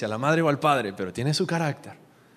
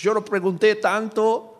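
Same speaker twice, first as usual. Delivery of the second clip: slow, higher-pitched, put-upon retort; mock annoyance; 5 semitones higher, 4 dB louder than usual. Listener cutting off the Bible's commands, mock annoyed. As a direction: act out an irritated brush-off, drawn out.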